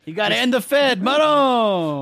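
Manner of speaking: high, loud mock Guido. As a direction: ham up a gun-nut Guido. Imitating libertarian Guido.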